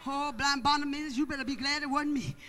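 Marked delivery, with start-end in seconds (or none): none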